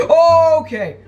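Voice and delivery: high, loud, drawn-out yelp; startled okay. Real, not acted. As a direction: yelp okay as danger jumps out. Startled mid-sentence in game.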